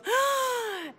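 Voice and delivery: sharp high gasp of delight; imitated gasp. Mother imitating her daughter's happy gasp on seeing her. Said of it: "she goes".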